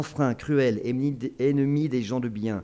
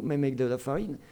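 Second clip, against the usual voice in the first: usual speaking voice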